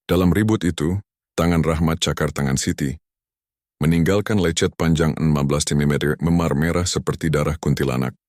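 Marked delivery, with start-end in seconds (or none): none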